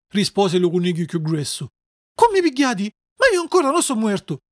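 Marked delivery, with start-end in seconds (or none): 2.1-4.4 high-pitched worried objection; alarmed protest. Acted. Puppet telling the rabbits he lives, alarmed.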